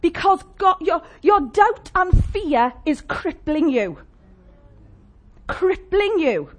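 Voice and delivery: slow, high, heavy emphasis; slow intense preaching. Acted. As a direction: lean hard on crippling.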